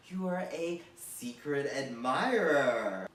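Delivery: drawn-out, loud SECRET ADMIRER; mock-grand proclamation. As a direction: stretch "admirer" out slowly with a swell. Mock grand title upgrade punchline.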